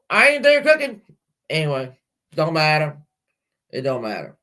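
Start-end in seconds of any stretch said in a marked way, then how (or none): 0.1-1.0 high, sassy refusal; defiant. Retold or imitated. Mother tells family she won't cook there, high and sassy. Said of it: "I was like".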